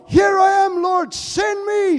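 loud, high, slow cry of the angels; angels calling out. Acted. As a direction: call it out like an eager angel. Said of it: "says the angels".

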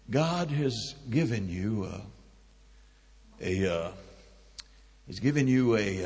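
hushed, low and hesitant; imitated whisper. Prophetic man to the team's leader, in a hesitant imitated whisper. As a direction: speak softly as if into an ear, groping for words. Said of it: "he whispered in Bob's ear".

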